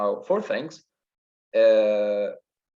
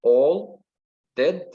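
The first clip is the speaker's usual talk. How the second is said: each word set apart, raised pitch; syllable by syllable; 4 semitones higher, 4 dB louder, much slower than usual. Shown as a demonstration. Teacher counting syllables in a sonnet line, syllable by syllable. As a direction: count out "All, dead, paper" with pauses. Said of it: "Okay, three and four".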